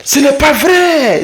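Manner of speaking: high, slow, laughing disbelief; laughing ce n'est pas vrai; amused disbelief. Shown as a demonstration. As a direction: stretch it out like you can't believe it. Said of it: "à peu près comme ça".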